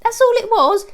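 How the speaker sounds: high, light coaxing voice to calm the dogs; sing-song reassurance, showing it's harmless; reassuring dog talk. Real, not acted. Owner shows her startled dogs the paper, high coaxing reassurance.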